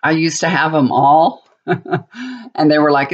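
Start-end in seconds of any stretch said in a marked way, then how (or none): none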